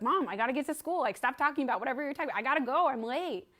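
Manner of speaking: put-on annoyed kid. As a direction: rush her, you're late and irritated. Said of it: "I was like".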